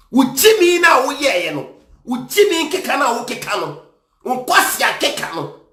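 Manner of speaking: heated, high-pitched telling-off; angry scolding. Real, not acted. Commentator berating the man in the clip, angry scolding.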